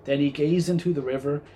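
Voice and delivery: ordinary delivery, nothing marked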